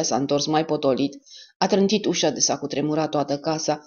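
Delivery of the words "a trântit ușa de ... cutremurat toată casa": plain reading voice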